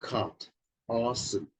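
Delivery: demonstrating the American ah; American vowel demo. Shown as a demonstration. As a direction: caught and awesome with open ah, /kɑt ɑsəm/. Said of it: "in order to say awesome".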